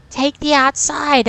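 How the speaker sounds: loud yell at another driver; road rage. Real, not acted. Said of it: "road rage [name] coming out".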